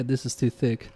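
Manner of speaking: ordinary talk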